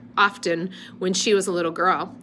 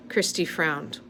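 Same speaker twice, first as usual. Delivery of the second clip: ordinary delivery, nothing marked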